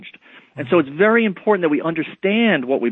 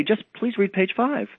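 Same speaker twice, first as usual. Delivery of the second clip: soft, higher, slow polite request; 5 semitones higher, 5 dB quieter than usual. Acted. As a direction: ask gently and very politely, taking your time. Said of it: "again, very politely".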